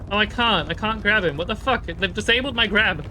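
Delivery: loud, high, exasperated gamer; frustrated outburst. Real, not acted. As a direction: yell at the game, annoyed.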